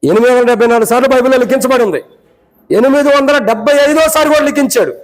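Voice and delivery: high-pitched, insistent preaching. Acted. (ఎత్తైన స్వరంతో ప్రసంగం)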